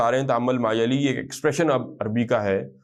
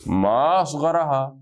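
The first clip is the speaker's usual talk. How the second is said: ما اصغرھا very slowly, ما leaned on and falling; slow model reading; 3 semitones higher, much slower than usual. Shown as a demonstration. Teacher modelling the exclamation for students, very slow. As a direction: stretch it for students to copy. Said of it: "کتنی چھوٹی ہے یہ".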